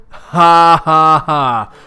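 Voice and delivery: loud, slow, sarcastic ha ha ha; mock laugh. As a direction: laugh out each syllable in scorn. Troll mocking laugh read aloud.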